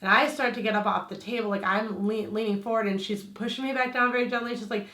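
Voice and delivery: ordinary talk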